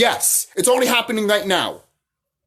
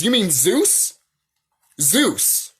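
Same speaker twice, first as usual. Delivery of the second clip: usual speaking voice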